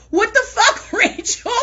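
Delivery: can't believe what she just heard; laughing disbelief. Real, not acted. Amazed at coworker blowing flies.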